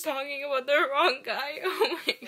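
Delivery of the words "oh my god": ordinary talk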